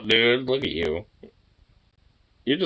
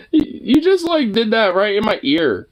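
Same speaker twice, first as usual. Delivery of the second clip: startled protest; 12 semitones higher, 5 dB louder than usual. Real, not acted. Dog licked his ear.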